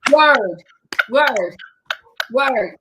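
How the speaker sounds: loud, punchy one-word hits; loud amen-style affirmation, spaced out; emphatic agreement. Real, not acted. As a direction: punch each repeat out on its own, with a falling tone.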